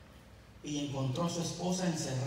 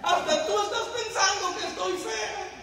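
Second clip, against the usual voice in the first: much higher, mock crying wife; 13 semitones higher, 5 dB louder than usual. Wife complains to her husband, mock crying and much higher. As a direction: whimper it like a hurt, crying wife. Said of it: "Y empezó a llorar más".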